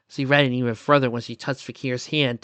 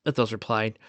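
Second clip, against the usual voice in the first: ordinary delivery, nothing marked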